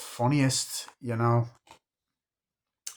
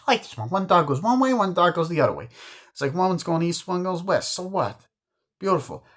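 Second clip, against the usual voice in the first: mocking the painting; 8 semitones higher than usual.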